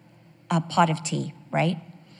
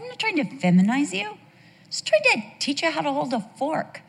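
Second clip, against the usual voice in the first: protesting mother; 8 semitones higher than usual. Retold or imitated. Mother's protest to screaming son, acted.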